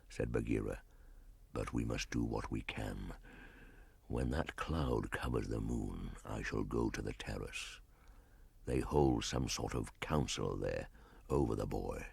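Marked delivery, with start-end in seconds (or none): 1.5-12.1 a big cat murmuring a worried wish; panther, low and soft. Acted. Panther voiced in story.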